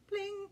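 high bright pling like a plucked string; sung pling. A narrator's reading. Imitating ukulele note.

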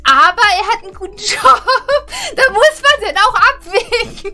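laughing through the words; giggling while saying it. Real, not acted. Laughing about [name]'s dumbness.

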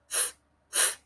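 f, f as a long voiceless blown f like blowing out a candle. Shown as a demonstration. Teacher showing children the candle sound, breathy blown f. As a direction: blow out f, f softly like a candle. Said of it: "it goes f".

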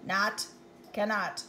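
usual speaking voice

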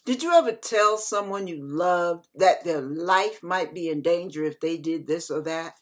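ordinary delivery

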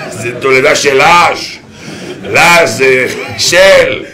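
playful rhyming sermon; Rabbi Landman rhyming. Retold or imitated. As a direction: chant the rhymes with rising gusto. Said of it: "מדבר תמיד בחרוזים".

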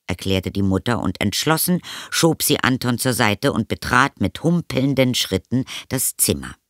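usual speaking voice

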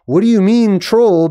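high-pitched mock bafflement; incredulous questioning. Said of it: "They're literally asking".

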